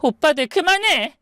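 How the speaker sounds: high-voiced woman's protest; angry sister. Wife in a wheelchair confronting her brothers, angry. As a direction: cry out to stop them, very high.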